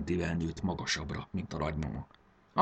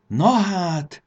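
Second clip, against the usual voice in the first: much higher and louder startled exclamation; boy finding a blue egg in his bed, crying out in surprise; surprised cry; 11 semitones higher, 8 dB louder than usual. Acted. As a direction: gasp it out in sudden amazement. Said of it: "kiáltott fel".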